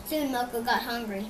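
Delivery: ordinary delivery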